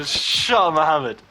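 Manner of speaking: loud mocking jab; punchy retold taunt. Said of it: "I just go".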